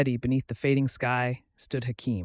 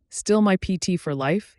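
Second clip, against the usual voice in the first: teasing; 6 semitones higher, 5 dB louder than usual. Acted. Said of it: "he teased".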